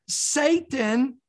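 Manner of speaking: ordinary talk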